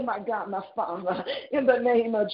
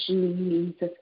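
ordinary talk, nothing marked

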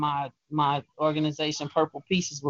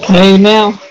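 much louder, excited cheer; loud hype; 5 semitones higher, 20 dB louder than usual. Real, not acted. Host cheering after the sexy poem, loud. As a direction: shout your approval.

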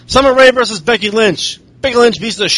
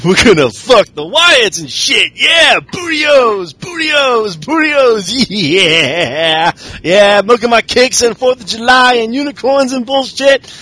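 hyped mock New Day; 3 semitones higher, slower than usual. Host mimics New Day hyping, shouting bootios. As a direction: hype it up like a goofy tag team chanting.

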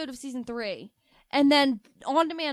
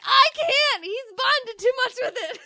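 laughing distress; 10 semitones higher, 6 dB louder than usual. Retold or imitated. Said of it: "And I was like".

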